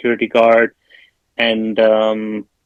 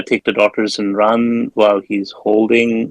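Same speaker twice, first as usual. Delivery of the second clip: normal talking voice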